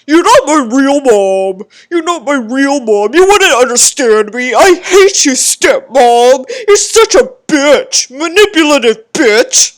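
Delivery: put-on loud, whiny sulky teenager voice; bratty teen. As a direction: sneer at the stepmom like a brat. Mocking whiny teen to stepmom.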